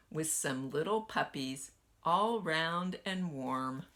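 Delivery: usual speaking voice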